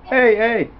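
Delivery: high, loud copycat call; high-pitched imitation; mimicking echo. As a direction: parrot back a squeaky greeting. Echoing the other's hey, hey.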